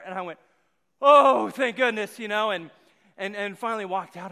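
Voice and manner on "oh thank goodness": relieved. Retold or imitated. Relief on finding his mom.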